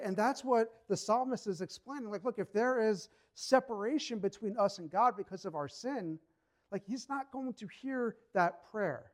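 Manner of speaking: ordinary talk, nothing marked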